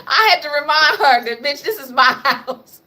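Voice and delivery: laughing through the words; real laughter breaking in. Real, not acted. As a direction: crack up while telling it. Laughing while retelling to the dog.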